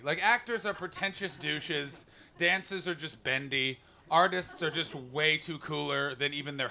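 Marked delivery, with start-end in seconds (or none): none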